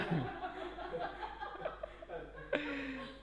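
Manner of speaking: high, soft laughter; hearty laugh. Real, not acted. Pastor laughing at his own joke, soft high laughter.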